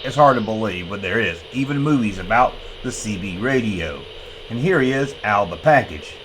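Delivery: ordinary talk, nothing marked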